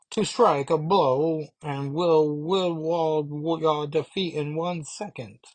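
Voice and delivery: high, slow, L's and R's as W's; lisping villain threat. Retold or imitated. A minion threatens Megan, high with W's for L's and R's. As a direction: menace through a comic speech impediment. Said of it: "the L's are W's too".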